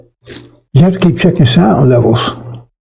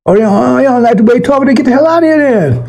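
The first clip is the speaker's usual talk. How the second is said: higher, scornful get-out-of-here; dismissive taunt; 9 semitones higher, faster than usual. Acted. Preacher scorning offended listeners, mocking dismissal. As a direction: wave the offended ones off with scorn.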